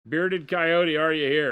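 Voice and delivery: loud, high call. Real, not acted. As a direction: call out to find him. Calling out for the winner.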